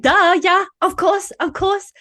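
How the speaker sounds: high, loud, gushing yes; eager yes. Retold or imitated. Host recalls her own eager yes to the guest, mock gushing. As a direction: gush like it's the best offer ever.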